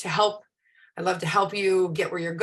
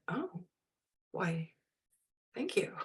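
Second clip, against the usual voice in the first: coy hushed thanks; 11 dB quieter, slower than usual. Retold or imitated. Her coy reply, quoted.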